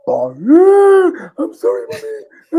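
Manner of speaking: high, loud sobbing pleas, voice cracking into a cry; bawling. Retold or imitated. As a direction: wail it out like a kid begging his mum. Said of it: "Proper, like, proper bawling".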